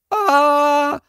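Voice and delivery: high, sustained scream; long shrill-pitched "aah" of alarm; queen's scream. A narrator's reading. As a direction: scream out high on one held note. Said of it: "заволала королева".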